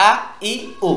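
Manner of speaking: "আ ই উ" cut short and sharp, each separate; "আ ই উ" with no lengthening; sharp short vowels. Shown as a demonstration. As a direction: say "আ", "ই", "উ" as crisp short bursts. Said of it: "এটা যেন হয়".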